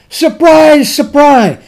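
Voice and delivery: high, loud sarcastic "surprise, surprise"; mock surprise. Shown as a demonstration. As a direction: ham up the surprise as a wrong reading. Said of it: "No, you don't try to translate it that way".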